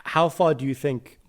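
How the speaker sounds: ordinary delivery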